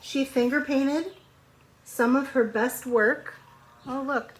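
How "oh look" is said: ordinary talk, nothing marked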